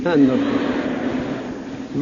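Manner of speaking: slow, hushed, breathy "hand of the core"; hushed repeat. Retold or imitated. Retelling the host mulling over the title, slow hushed "hand of the core". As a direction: draw out "hand of the core" softly under the breath. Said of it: "But he said better".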